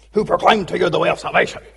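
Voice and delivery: hint of an irritated possessed girl; grouchy angry girl. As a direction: snap the question out, mildly angry and hurried. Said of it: "Grouchy, angry".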